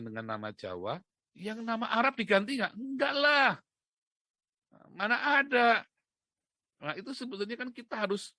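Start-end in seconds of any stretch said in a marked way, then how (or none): 2.7-5.9 loud, high, exclaimed dismissal; emphatic denial. Real, not acted. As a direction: throw it out as a raised-voice rebuttal. Answers own rhetorical question scornfully.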